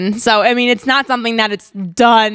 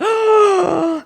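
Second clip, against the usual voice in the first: meme gasp; 11 semitones higher than usual. Retold or imitated. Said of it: "that meme from twenty twelve".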